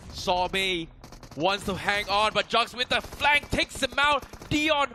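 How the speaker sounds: loud, rising hype play-by-play; esports caster. Real, not acted. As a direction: call the fight with building excitement. Caster calls fight climax live.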